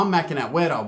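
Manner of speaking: ordinary delivery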